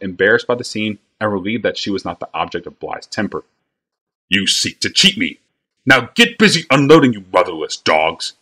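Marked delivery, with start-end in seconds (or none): 4.3-8.3 sputtering rage. A narrator's reading. Wagon master sputtering at the boys, enraged. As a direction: sputter it out, red in the face. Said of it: "sputtered Bly".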